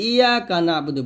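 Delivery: "ইয়া কানা আবুদু" quick, with a single y; rushed everyday "ইয়া কানা"; mistaken undoubled y. Shown as a demonstration. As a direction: say "ইয়া" lightly, no doubling or stretching. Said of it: "আমরা এখানে যে ভুলটা করি".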